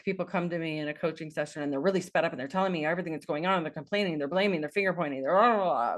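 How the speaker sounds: fast breathless rush of words; sped-up rant. Shown as a demonstration. Coach imitating a rushed, complaining client, fast and breathless. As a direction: race through it without breathing. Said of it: "I can't even breathe when I talk like that".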